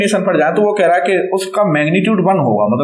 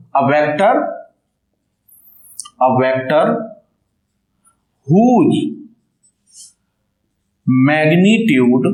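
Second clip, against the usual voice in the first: word by word, drawn out with long pauses; measured note-taking pace; slow dictation; much slower than usual. Real, not acted. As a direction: dictate a definition for students to copy down. Dictates definition for notes.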